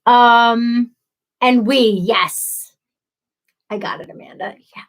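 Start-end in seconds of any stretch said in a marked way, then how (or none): 3.7-4.8 whisper. Real, not acted. Aside to someone off mic.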